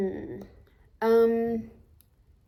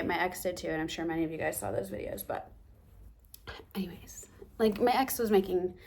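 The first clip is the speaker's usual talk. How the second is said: ordinary delivery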